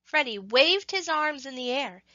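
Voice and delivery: usual speaking voice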